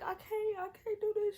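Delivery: high, loud, breaking sob-whisper; choked, tearful I can't; retold crying. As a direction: relive the panic, voice cracking into tears. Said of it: "I was just crying".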